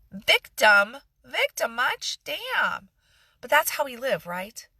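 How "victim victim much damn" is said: mocking. Mocking the victim mindset.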